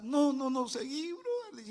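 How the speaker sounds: high and slow, waving off the worry; no no no, just keep going; excited brush-off. Retold or imitated. Driver brushes off the passenger's fear of crashing, excited.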